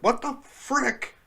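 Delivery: mock prim curse. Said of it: "they're like".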